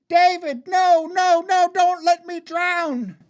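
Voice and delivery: very high, loud terrified plea; desperate screaming. Acted. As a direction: scream as if about to drown. Drowning sister begs brother.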